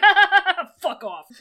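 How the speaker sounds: big, loud, high fake villain laugh, then a dismissal; mock menacing laugh. Sect leader mocking a distinguished guest, hammed-up menacing laugh. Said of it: "do a big menacing laugh".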